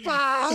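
high loud frustrated ah; mock exasperated shriek. Frustrated at the news.

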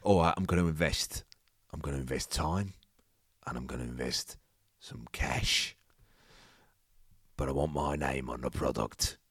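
low, deliberate Cockney offer; deep Cockney boss weighing each phrase; put-on Cockney dragon; Cockney investor. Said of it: "Oh, he's Cockney".

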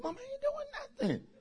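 high, soft innocent denial; innocent son. Retold or imitated. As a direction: play the boy feigning innocence to mama. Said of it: "I said".

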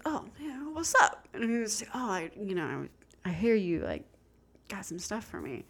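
soft, mumbly fake-casual voice; mock someone playing it cool, kept quiet; trying to be cool. Fiance approaching a dealer, imitated soft fake cool. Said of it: "he's trying to be all cool".

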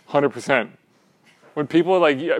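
normal talking voice

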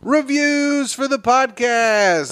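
high, drawn-out segment call; segment announcer. Pretend. Podcast host introducing the best segment, hammed-up announcer call. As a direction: sing out the segment title, stretching it slowly.

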